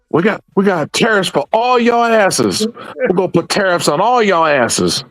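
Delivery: high-pitched hammed-up board-game taunt; mock threat. Quoting himself and friend playing Risk, high mock threat. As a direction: crow threats in a high put-on voice. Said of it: "We're like".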